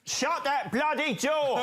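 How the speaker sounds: shouting. Acted. Impression spelling game, shouted line.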